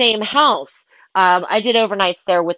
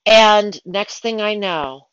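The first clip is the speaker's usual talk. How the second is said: ordinary talk, nothing marked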